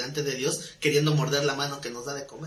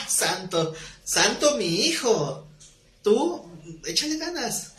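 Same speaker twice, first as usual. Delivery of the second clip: put-on God humoring his child, slow then much higher and pleading; indulgent God; 6 semitones higher, slower than usual. God indulgently urging a boastful believer: slow, then much higher. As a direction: pat him on the head, then urge him on, rising high.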